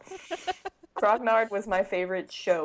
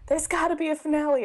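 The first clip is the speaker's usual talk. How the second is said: quoting Mikey; 8 semitones higher, 4 dB louder, much faster than usual. Retold or imitated.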